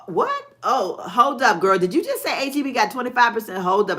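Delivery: loud, high surprised outburst; sudden high surprise. Real, not acted. Surprise at viewer's news.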